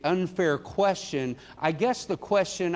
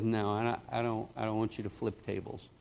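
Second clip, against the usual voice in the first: lower, much softer, gentle no; a soft, kindly refusal; gentle Jesus; 7 semitones lower, 8 dB quieter than usual. Retold or imitated. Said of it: "the way I just said it is not the way Jesus said it".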